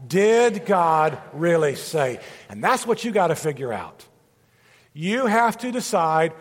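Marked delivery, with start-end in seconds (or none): 0.1-2.2 slow, weighty, stress on Did; slow emphasis. Shown as a demonstration. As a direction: let each word land slowly. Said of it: "Say it with me out loud".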